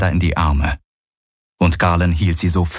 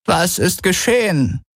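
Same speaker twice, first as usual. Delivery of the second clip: much higher, urgent question; anxious elder; 12 semitones higher than usual. Acted. Kessli asks what happened, high and anxious. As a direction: demand news with worry.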